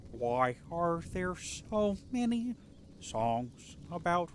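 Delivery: slow, sung puppet frog voice; Kermit the Frog voice. Pretend. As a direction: croon it like Kermit. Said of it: "Do y'all like my Kermit the Frog voice?".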